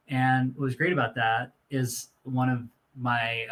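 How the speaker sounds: usual speaking voice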